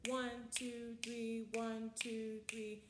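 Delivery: one, two, three counted evenly in threes; counting triple meter. Shown as a demonstration. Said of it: "and the triple meter".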